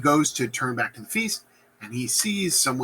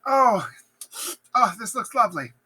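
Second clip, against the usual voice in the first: high, cooing approval; mock delight; 7 semitones higher than usual. Hecate admiring the witches' foul brew, high mock delight. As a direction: coo over it admiringly.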